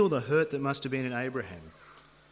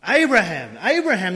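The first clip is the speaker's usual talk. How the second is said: loud, higher-pitched call from above; urgent yell; 6 semitones higher, 12 dB louder than usual. Acted. Angel to Abraham as he goes to thrust, urgent yell. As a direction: yell the name twice, full force. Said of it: "this voice yells".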